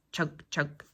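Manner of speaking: low rumbling tractor chug; chugging tractor. Acted. Tractor sound effect.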